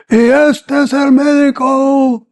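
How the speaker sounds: high, slow, loud Spanish proclamation; commander's shout. Retold or imitated. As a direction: bellow it out to the whole village.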